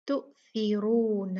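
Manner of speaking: तुसिरून slow, with a full heavy ra; recited slowly, heavy ra. Shown as a demonstration. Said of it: "तो रा मोटी पढ़ी जाती है".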